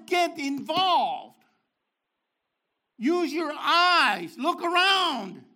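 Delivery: slow, loud, high emphatic words; urging. Real, not acted. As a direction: drive each word home slowly. Preacher urging the congregation.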